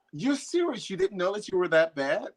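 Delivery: mock-amazed, as if the question were absurd; incredulous friends. Friends reacting to being asked if she's a perfectionist, amazed. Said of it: "They go".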